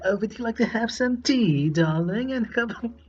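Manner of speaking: playing a posh Brit offering tea, badly; British accent. Movie reviewer jokingly offers tea in a bad British accent. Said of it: "that was me trying to do a British accent".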